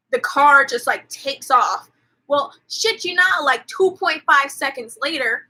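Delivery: ordinary talk, nothing marked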